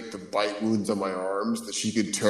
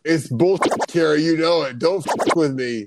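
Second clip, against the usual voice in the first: angry; 6 semitones higher, 4 dB louder than usual. Real, not acted. Mock-angry outburst at cohost.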